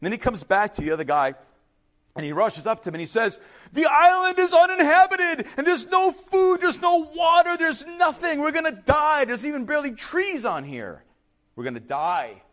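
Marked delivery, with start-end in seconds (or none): 3.7-12.4 high, loud panicked outburst, easing at the end; acted freak-out. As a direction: play a castaway losing it. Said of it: "he's freaking out".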